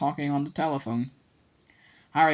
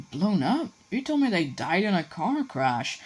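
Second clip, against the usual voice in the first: higher, stunned and confused; shocked question; 6 semitones higher than usual. A narrator's reading. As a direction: ask in shocked disbelief. Harry shocked at lie.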